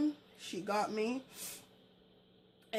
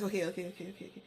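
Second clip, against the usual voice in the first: softer, soothing repeated okays; softer fading okays to calm her; placating; 4 semitones lower, 7 dB quieter, much faster than usual. Retold or imitated. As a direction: settle her down gently. Placating cousin.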